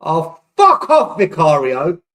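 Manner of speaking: loud, slow, disgusted yell at the keeper; furious shout. Real, not acted. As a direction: let the keeper have it, drawn out. Keeper concedes, fan furious.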